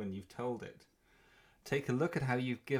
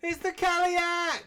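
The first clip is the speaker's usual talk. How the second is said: excited; 5 dB louder, slower than usual. Pretend. Announcing the crow's arrival.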